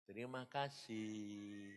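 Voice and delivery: soft, slow "Terima kasih", barely voiced; hushed, drawn-out "Terima kasih"; quiet thanks. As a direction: say "Terima kasih" softly and slowly, resigned. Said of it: "terus kamu bilang".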